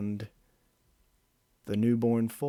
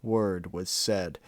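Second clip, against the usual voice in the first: plain reading voice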